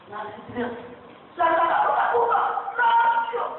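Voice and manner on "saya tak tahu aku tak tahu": put-on high whiny child. Child voiced denying.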